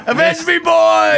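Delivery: high, loud dying shout; overacted death scene. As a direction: cry it out like a fallen hero. Echoing the movie death line.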